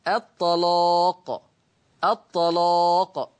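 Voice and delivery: slow الطلاق with a thick, dark لام; teacher lets the class hear the heavy lam. Shown as a demonstration. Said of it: "سامعين صوت التغليظ لللام".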